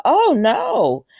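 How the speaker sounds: high, loud dismayed gasp of words; shocked oh no. Retold or imitated. Retelling her reaction to the diagnosis, a shocked cry. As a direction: react like bad news just hit.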